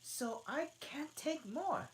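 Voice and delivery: put-on high, slow, huffy girl; offended. Alice refusing the Hare, offended and high. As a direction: sniff it out. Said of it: "with an offended tone".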